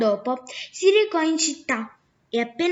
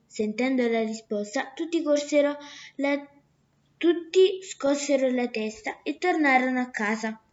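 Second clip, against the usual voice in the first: ordinary delivery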